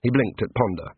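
usual speaking voice

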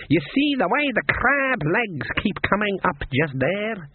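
high, slow voodoo woman; psychic voodoo woman. A narrator's reading. Voodoo woman showing the cook her pot, psychic. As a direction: draw it out as a seer peering into the pot.

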